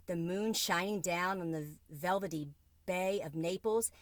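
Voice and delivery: ordinary delivery, nothing marked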